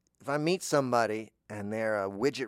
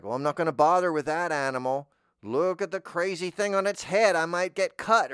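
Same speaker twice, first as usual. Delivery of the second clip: louder, higher voice of a wary animal; voicing a wary animal; 6 semitones higher, 8 dB louder than usual. Animal eyeing a creature with a crazy head, mock wary. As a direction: ham up a predator backing off. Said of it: "It's like".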